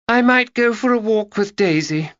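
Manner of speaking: a touch of pleading, lightly done; plaintive. Acted. Girl tired of sorting books asks plaintively. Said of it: "she said plaintively".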